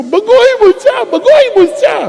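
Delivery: high, loud pleading demand; a husband demanding change of his wife; insistent demand. Retold or imitated. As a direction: press God to change her.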